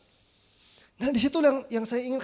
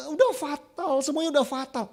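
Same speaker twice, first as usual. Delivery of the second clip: crying student; 5 semitones higher than usual. Retold or imitated. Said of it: "dia nangis gitu ya".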